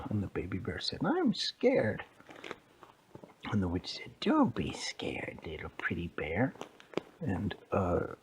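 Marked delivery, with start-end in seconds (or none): 1.1-2.0 very high little bear voice; high-pitched baby bear; scared baby bear. Acted. 4.2-6.5 witch voice. Acted. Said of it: "Why are you talking like that".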